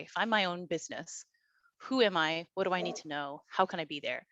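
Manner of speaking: ordinary talk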